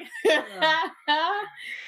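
mock helpless chuckling; [name]'s chuckle. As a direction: laugh as if failing to hold it in. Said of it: "[name] chuckles a little harder".

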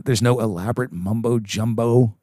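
ordinary talk, nothing marked

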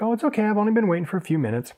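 breezy polite brush-off; client voice. Retold or imitated. Waiting client reassuring the stylist, high and quick.

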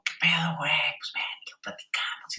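a faint far-off call, almost a whisper; like a yell barely heard from down the street; distant shout. Retold or imitated. Recalling his friend's shout heard from far away, faint and breathy. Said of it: "escuchaba el grito como".

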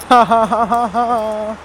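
steady ha-ha-ha laughter; amused laugh after a rant; one long chuckle; rolling chuckle. Real, not acted.

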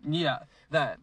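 usual speaking voice

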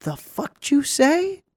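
high, slow and drawn out in disbelief; stunned disbelief. Retold or imitated. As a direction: hear something outrageous and stretch it out. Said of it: "And I'm just like".

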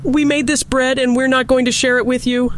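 stubborn little hen holding her ground; defiant hen. A narrator's reading. Hen refusing the lazy dog and cat, high defiant voice. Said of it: "said the little red hen".

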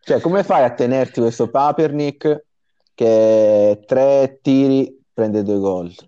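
ordinary delivery

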